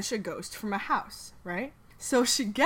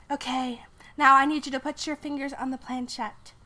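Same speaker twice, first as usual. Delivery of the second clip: Sally voice; 4 semitones higher, 3 dB louder than usual. Acted.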